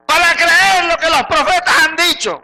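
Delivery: high, slow shouted reproach; angry rebuke. Acted. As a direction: keep bellowing the scolding, word by word. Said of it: "el Señor te lo dijo bravo". (reproche bravo, a voz en grito)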